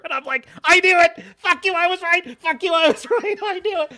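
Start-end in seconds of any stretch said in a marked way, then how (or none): 0.6-1.2 high, loud triumphant cry; a loud victorious yell; gleeful shout. Retold or imitated. Gloating that he was right. 1.4-2.4 high, loud taunting gloat; a loud triumphant jeer; gloating shout. Retold or imitated. Gloating that he was right. 2.4-3.9 high, loud gloat breaking into a laugh; giggling triumphant taunt. Retold or imitated.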